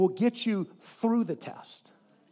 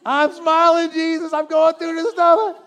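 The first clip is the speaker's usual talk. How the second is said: higher, louder, strained happy voice; forced cheerful; 7 semitones higher, 7 dB louder than usual. Pretend. Believer telling Jesus he's cheerful through trials, forced cheer. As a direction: fake a big grin through gritted teeth.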